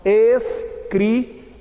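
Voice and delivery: escri- broken as "es... cri"; "es", pause, "cri"; distinct pronunciation. Shown as a demonstration. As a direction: separate the s from the c in escri-. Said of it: "é pronunciado distintamente".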